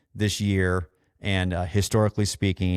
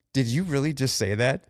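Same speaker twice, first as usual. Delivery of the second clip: incredulous; 4 semitones higher than usual. Retold or imitated. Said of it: "I'm like".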